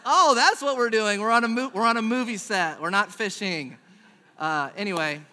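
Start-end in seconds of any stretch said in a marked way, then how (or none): 0.1-3.8 loud, high burst of dawning realization; sudden realization. Retold or imitated. Improv novice realizing the scene, loud mock realization. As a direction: blurt it out, easing off toward the end.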